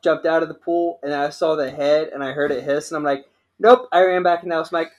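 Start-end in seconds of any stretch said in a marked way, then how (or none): 3.6-3.9 panicked nope. Retold or imitated. Seeing alligator in pool.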